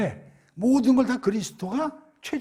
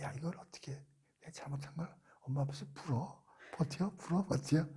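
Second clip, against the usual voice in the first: softer, half-whispered fretting; inner whisper; 2 semitones lower, 11 dB quieter than usual. Acted. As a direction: mutter the dilemma to yourself. Guilty inner monologue. (양심에 찔린 속마음을 속삭이듯)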